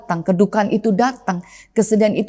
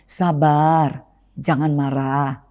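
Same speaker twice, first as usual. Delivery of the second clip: soothing advice; 6 semitones lower, much slower than usual. Retold or imitated. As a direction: talk an angry friend down gently. Calming someone who is angry.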